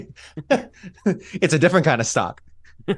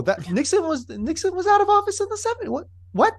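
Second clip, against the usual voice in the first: incredulous. Real, not acted. Protesting wrong date.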